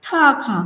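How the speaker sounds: "ঠা খা" drawn apart, air puffed on both consonants; two breathy bursts in "ঠা খা"; aspirated T and K. Shown as a demonstration. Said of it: "টাকার সাউন্ডও যদি আমরা দেখতে". ("ঠা খা"-য় ঠ ও খ দুটোই মহাপ্রাণ)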